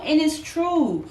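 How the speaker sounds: ordinary talk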